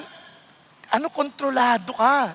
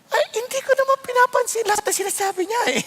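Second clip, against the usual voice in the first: retold reply; 9 semitones higher, faster than usual. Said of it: "Sabi ko".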